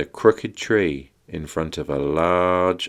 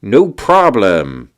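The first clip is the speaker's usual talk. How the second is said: loud, stretched, higher reply; piping up; 4 semitones higher, 9 dB louder, slower than usual. Acted. Shark Wizard offers help to witches, piping up. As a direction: pipe up loudly and obligingly. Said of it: "piped up Shark Wizard".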